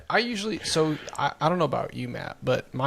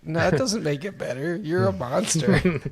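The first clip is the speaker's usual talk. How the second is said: higher, quick scolding; mock outrage; 6 semitones higher than usual. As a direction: protest in playful indignation, pitched up. Retells friends' mock outrage at belcher.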